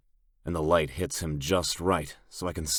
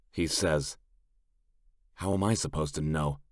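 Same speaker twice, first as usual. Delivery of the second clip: ordinary delivery, nothing marked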